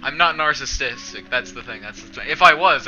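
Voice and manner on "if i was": louder sudden emphasis; loud burst. Real, not acted. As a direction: punch it out loud. Emphatic joke setup.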